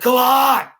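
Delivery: high, loud yell to the opponent; fired-up shout. Retold or imitated. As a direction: roar him on, pumped and wild. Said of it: "I was like".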